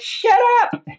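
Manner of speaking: very high, loud mock shriek; hammed-up outraged screech; screaming, imitated. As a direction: scream it like an irate neighbor from a window. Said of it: "screaming out her window".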